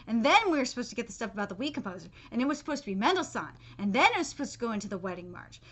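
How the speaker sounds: quick low run-through; rushed recap. Real, not acted. Reviewer listing the skipped story beats quickly. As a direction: rattle it off fast.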